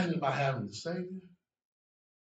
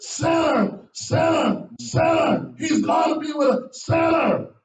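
higher, shouted jeering; shouted taunt; 13 semitones higher, 9 dB louder, slower than usual. Retold or imitated. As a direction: yell it like a mocking crowd. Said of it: "probably followed up with".

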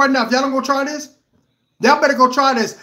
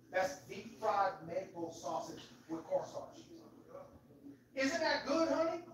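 far off; 5 semitones lower, 20 dB quieter than usual. Real, not acted. Offering wife a bite offscreen.

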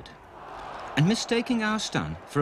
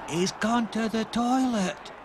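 put-on higher, slower child voice; young Stanley; 10 semitones higher than usual. Stanley telling the crowd where Stopper went, childlike. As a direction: answer simply like a small boy. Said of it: "said Stanley".

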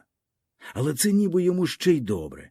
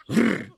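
growl; 9 semitones higher than usual. A narrator's reading. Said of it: "хрукнув".

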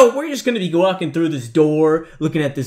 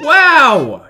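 high, loud drawn-out yelp; startled yell; 10 semitones higher, 7 dB louder than usual. Real, not acted. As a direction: cry out in shock. Jump scare while walking.

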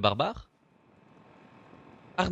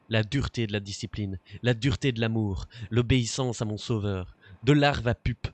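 poem reading; 3 dB louder than usual. Acted. Reading the kid's gloomy birthday poem aloud, mildly dramatic. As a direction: give each word weight like a grim poem.

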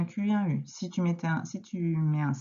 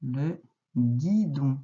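very slow and deliberate; slow dictation; 2 semitones lower, slower than usual. Shown as a demonstration. Teacher dictating to a pupil, very slowly. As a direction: stretch every syllable for spelling.

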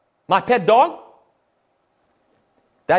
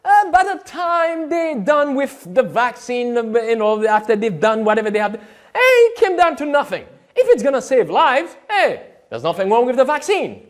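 mocking Doug Batchelor; 4 semitones higher than usual. Mocking the pastor's vaccine words to viewers, higher and sneering. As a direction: parody him as glib and careless. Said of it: "Doug Batchelor just said".

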